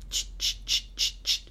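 whispered ch ch spray hiss; spray noise. A narrator's reading. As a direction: puff little whispered spray sounds.

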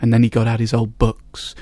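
ordinary delivery, nothing marked

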